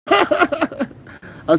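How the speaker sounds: high burst of laughter; laughing hard mid-story. Real, not acted. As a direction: crack up at the memory. Laughs at his own story.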